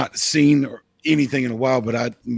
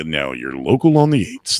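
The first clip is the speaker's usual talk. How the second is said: local weather announcer; 7 semitones lower than usual. Retold or imitated. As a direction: play the weather-channel announcer, understated. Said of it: "I can do the local on the".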